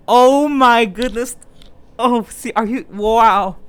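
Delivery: slow, loud, drawn-out amazement; amazed. Real, not acted. Reader reacts to the brother's reveal, amazed. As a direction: stretch out the astonished exclamation.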